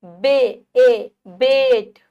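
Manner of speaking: बेट sounded out slowly, ए loud. Shown as a demonstration. Teacher sounding out बेट for the children. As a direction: hit the ए in बेट. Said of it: "ए की ध्वनि आ रही है".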